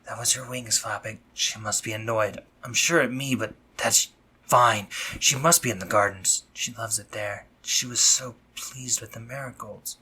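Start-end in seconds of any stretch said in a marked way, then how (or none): none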